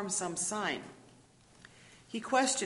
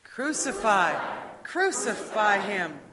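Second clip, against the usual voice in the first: loud, slow chant of the crowd; crowd shouting; 7 dB louder, slower than usual. Acted. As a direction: bellow it out as the angry mob.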